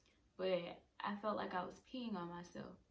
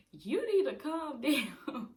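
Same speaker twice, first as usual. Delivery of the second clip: loud, high, drawn-out order; hammed-up exasperated yell; mock sister yell; 6 semitones higher, 10 dB louder, slower than usual. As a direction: imitate a sister shouting over the chaos, half laughing. Imitates sister in family shouting match.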